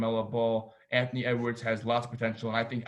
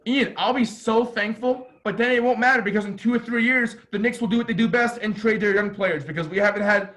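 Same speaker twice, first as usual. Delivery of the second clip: higher, louder, worked-up venting; real exasperation, pitched up and pushed louder; exasperated fan rant; 6 semitones higher, 4 dB louder than usual. Real, not acted. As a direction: rant like a long-suffering, fed-up fan. Exasperated fan ranting about his team.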